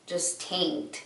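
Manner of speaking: normal talking voice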